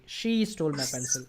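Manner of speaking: "she" picked out, then falling away; emphatic opening "she"; stress on she. Shown as a demonstration. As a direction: hit "she" and let the rest drop. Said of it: "I just focused on she".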